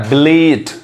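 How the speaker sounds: ब्लीट with a drawn-out ee; long vowel. Shown as a demonstration. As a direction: stretch the ee in ब्लीट. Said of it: "तो ये long sound है".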